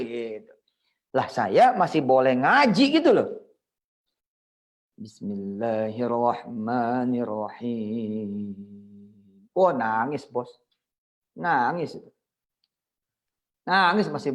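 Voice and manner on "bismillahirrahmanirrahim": slow tearful recitation. Acted. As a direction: recite it slowly as if about to weep. Reciting, moved to tears. (melantunkan basmala pelan sambil menahan tangis)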